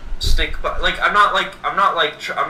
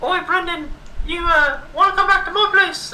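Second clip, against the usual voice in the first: much higher squeaky mock British invite; a cartoon Brit flirting, pitched way up; mock British accent; 14 semitones higher than usual. Daisy Ridley inviting him home, squeaky mock British. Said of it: "Daisy Ridley's gonna come up to me".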